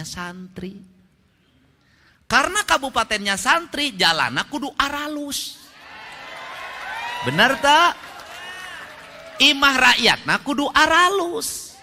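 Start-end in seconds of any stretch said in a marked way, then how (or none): 2.3-11.7 high ringing rally shout; rally speech. Acted. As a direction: rouse the crowd, call it out. Rally call to crowd.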